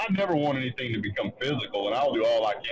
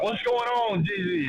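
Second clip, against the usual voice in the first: raised mock challenge, much higher; mock confrontation; 10 semitones higher, slower than usual. Retelling Jesse storming out, hammed-up challenge. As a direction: play the angry neighbor calling out.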